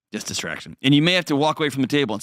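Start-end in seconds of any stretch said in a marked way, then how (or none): none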